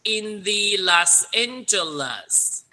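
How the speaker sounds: slow, even model reading; slow read-along. Shown as a demonstration. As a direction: lead the class word by word. (慢速领读)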